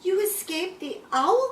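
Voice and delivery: high, loud cry of amazement; excited cry. A narrator's reading. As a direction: cry it out in high-pitched relief. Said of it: "cried Stella Luna".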